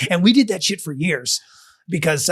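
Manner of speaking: ordinary talk, nothing marked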